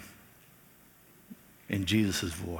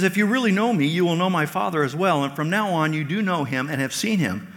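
higher and louder, reading Jesus's words; lifted scripture reading; raised reading voice; 7 semitones higher, 6 dB louder than usual. Acted. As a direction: proclaim it to the room. Preacher reading Jesus's words aloud.

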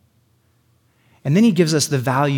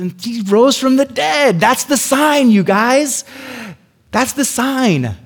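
much higher, louder, slowing on the climax; triumphant proclamation; 10 semitones higher, 6 dB louder, slower than usual. Real, not acted. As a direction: proclaim the resurrection like the big reveal.

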